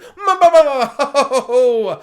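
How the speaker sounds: booming hammed-up evil laugh; mock villain laugh. Disney gloating over the fans, hammed-up villain laugh.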